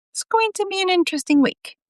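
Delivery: high amused voice; Bob voice. A narrator's reading. Bob's voice.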